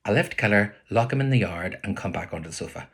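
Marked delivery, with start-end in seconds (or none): none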